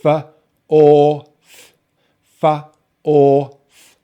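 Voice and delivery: segmented demo with "or" held loud; sound-by-sound breakdown. Shown as a demonstration. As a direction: sound out f - or - th with pauses. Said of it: "we finish on the T H".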